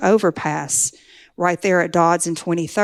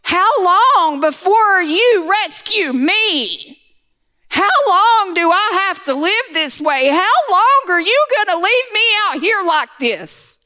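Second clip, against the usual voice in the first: very high, louder, slow anguished wail; desperate retold scream climbing ever higher; screaming at God; 11 semitones higher, 4 dB louder, slower than usual. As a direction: hurl the questions up at heaven, stretching them out. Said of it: "I start screaming".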